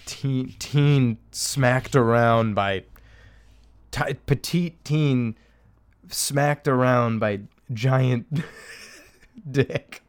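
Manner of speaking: hammed-up slow porn-title read; mock porn title. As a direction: announce it like a trashy headline.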